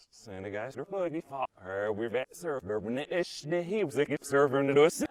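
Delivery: garbled reversed-sounding talk; mock backwards speech. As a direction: talk as if played backwards, Tenet style. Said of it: "in honor of this being a Tenet episode".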